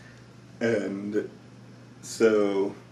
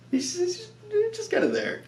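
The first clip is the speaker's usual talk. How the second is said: much higher, squeaky little-creature voice; baby Yoda voice. Retold or imitated. Recapping the show, voicing the cute baby in a squeak. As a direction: squeak it like a tiny alien baby.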